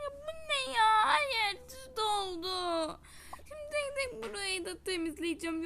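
high teary wail of frustration; frustrated crying. Real, not acted. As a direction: sob out your clumsiness. Salt spilled everywhere, frustrated. (ağlamaklı, sinirli yakınma)

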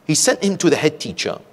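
ordinary delivery

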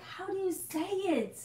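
high squeaky "How do you say it?"; mock confused viewer. Viewers debating the name, asking in a squeaky high voice. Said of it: "like, debating".

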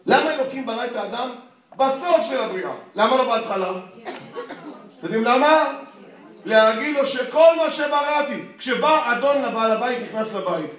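loud, high, impassioned preaching; preaching at full force. Acted. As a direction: build the point to a fervent peak. Rising passionate sermon point.

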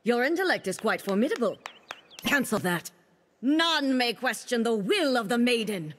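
usual speaking voice

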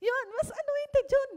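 high, loud laughing delivery. Real, not acted. Laughing while praising the other minister. (tumatawang malakas)

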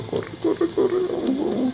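excited high urging. Real, not acted. Urging car across bridge.

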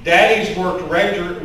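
ordinary delivery

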